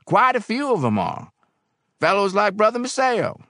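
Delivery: high, loud confiding barkeep; barkeep's emphatic, high-pitched answer; barkeep Barrel House. A narrator's reading.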